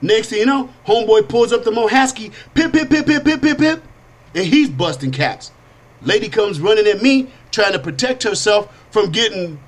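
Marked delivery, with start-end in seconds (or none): 2.6-3.8 high rapid pip pip shots; imitated gunfire. Homeboy busting caps at a business deal, high gunshot sounds. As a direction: rattle the shots off high and quick. Homeboy pulls gun and fires.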